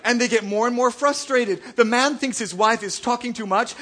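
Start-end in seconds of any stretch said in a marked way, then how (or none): none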